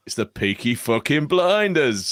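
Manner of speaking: Peaky Blinders gangster voice; Brummie accent. Pretend. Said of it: "Don't call me a fucking brummie".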